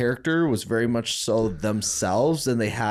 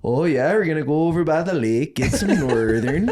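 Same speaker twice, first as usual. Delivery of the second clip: higher, louder, hammed-up Minnesota accent; Minnesota homies impression; 5 semitones higher, 3 dB louder than usual. Homies back home planning a lake trip, hammed-up Minnesota accent. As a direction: lay the Minnesota vowels on thick. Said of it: "all my homies were like".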